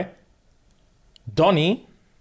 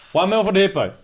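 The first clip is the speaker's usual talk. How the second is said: raised-pitch replay of the bid; emphatic repeat; 6 semitones higher than usual. Retold or imitated. Storyteller replaying the big bid to stun listeners, emphatic. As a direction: repeat the bid higher, for impact.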